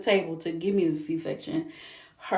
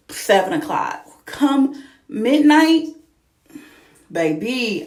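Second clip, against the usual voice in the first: ordinary talk, nothing marked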